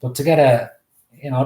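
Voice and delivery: ordinary talk, nothing marked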